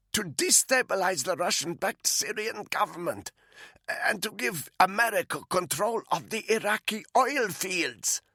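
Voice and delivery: a frightened captive pleading; captive woman's voice. Acted. Malinka explains US motive.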